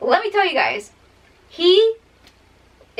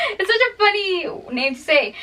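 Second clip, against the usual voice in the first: laughing; 7 semitones higher than usual. Real, not acted. Laughing at the name.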